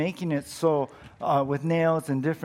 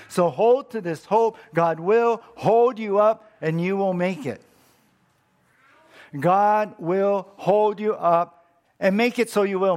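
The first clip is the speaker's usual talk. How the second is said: higher, louder preaching build; full-force preaching; 5 semitones higher, 5 dB louder than usual. Acted. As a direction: preach it out to the whole church.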